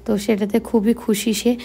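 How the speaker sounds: ordinary talk, nothing marked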